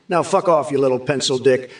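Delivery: ordinary delivery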